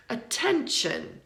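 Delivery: "Attention" slowly, uh-TEN-shun; schwa demonstration. Shown as a demonstration. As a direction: draw out "Attention", weak first vowel, stressed middle. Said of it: "there's a schwa".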